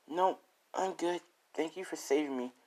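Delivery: gentle, high and quiet thanks; rescued girl. Acted. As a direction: lightly voice a shy girl thanking her rescuer. Rescued girl thanks her hero.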